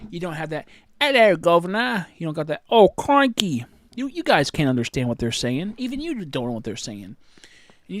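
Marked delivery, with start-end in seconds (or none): 1.0-2.1 loud, high hammed-up English accent; exaggerated English accent shouted as a joke; mock English accent. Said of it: "I have an English voice". 2.7-3.7 loud, high hammed-up English accent; exaggerated English accent shouted as a joke; mock English accent. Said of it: "I have an English voice".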